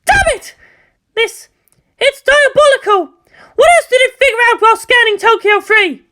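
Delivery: very high, loud furious outburst; angry outburst. Acted. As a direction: rage at the wall. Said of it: "punched down at the metal wall".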